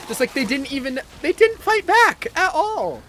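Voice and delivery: loud, high-pitched, incredulous complaint; exasperated outburst. Real, not acted. Streamer complains that enemies never fought back, exasperated shout. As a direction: raise voice and pitch in disbelief at the enemies.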